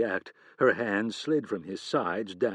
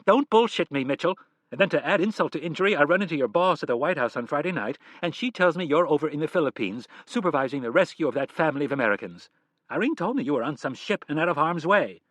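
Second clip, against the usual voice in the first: furious; 6 semitones higher, 7 dB louder than usual. Acted. Wife's furious rant.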